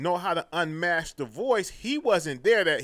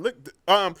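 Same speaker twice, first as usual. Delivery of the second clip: ordinary talk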